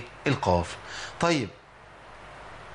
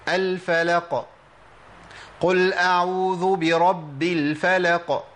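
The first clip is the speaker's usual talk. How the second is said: الفلق recited very slowly with bouncing qaf; slow Quran recitation; slow recitation demo; 2 semitones higher, much slower than usual. Shown as a demonstration. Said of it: "المرتبة الثانية".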